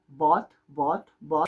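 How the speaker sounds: bought said with a closed A-like vowel, bot; American bought. Shown as a demonstration. Teacher modeling bought for Spanish learners, closed A vowel. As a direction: stretch bought toward a closed A. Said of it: "vamos a empezar con una especie de A, un poco cerrada".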